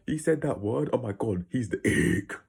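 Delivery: loud, high mock teen gasp; hammed-up grossed-out kid; mocking immature teen.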